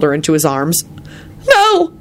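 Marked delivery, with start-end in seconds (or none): 1.5-1.9 very high, loud wail. A narrator's reading. Elizabeth refuses to be taken back, wailing. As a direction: wail it out in protest. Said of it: "Elizabeth wailed".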